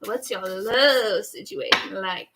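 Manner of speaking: slow, playful imitation of a flirt; teasing, stretched-out question; flirty drawl. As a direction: lean long on "love". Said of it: "Virgos talk like that".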